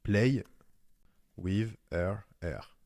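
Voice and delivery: halting English in a French accent; slow, separated English words, real French accent; accent français. Real, not acted. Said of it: "Désolé pour mon accent". (anglais lent avec l'accent français)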